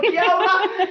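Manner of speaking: laughing kia ora. Real, not acted. Laughing after a joke.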